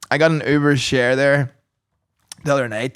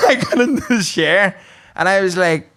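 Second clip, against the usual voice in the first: usual speaking voice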